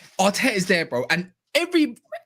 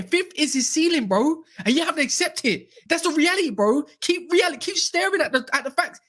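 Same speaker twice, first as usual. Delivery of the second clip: worked up; 7 semitones higher than usual. Real, not acted. Heated football argument, raised voice.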